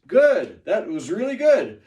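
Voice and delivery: big bright Good; excited teacher praise; loud praise. Real, not acted. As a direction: cheer the class on.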